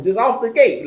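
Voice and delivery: usual speaking voice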